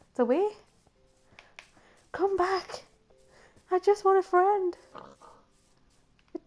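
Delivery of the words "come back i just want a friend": high, loud plea after a fleeing dog; high, loud begging call; pleading call. Real, not acted. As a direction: call the dog back, almost whining. Dog runs away.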